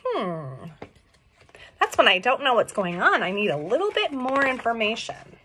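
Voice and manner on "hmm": soft puzzled hum; quiet thinking hmm; confused hum. Shown as a demonstration. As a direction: hum like you're puzzling something out. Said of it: "Usually my confused face is".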